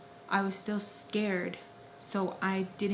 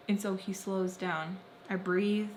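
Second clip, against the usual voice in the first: usual speaking voice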